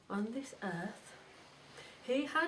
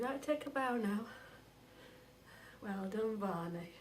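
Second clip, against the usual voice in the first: ordinary delivery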